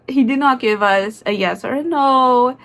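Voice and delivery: ordinary talk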